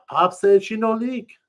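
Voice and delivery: high, loud pidgin reassurance; reassuring pidgin. Acted. Sweetie assures them the canoe won't leak, high pidgin. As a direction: brush off the worry.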